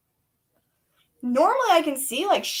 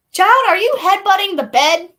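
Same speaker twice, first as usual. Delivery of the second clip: real raised-voice alarm; startled scolding; 7 semitones higher, 9 dB louder than usual. Real, not acted.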